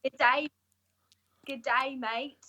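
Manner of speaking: lightly mocking Australian greeting; a mild Aussie twang on the hello; hint of an Australian accent.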